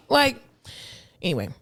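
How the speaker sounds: ordinary talk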